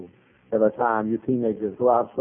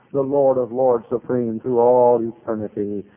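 usual speaking voice

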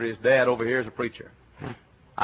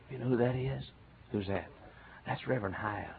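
gossiping whisper; 12 dB quieter than usual. Retold or imitated. Whispering townsfolk retold.

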